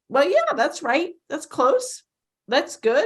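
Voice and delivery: high, sing-song praise; cheerful high "that's close" praise; mock approving. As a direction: imitate a lenient tutor accepting a wrong answer. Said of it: "And then people go like".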